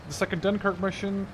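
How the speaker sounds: usual speaking voice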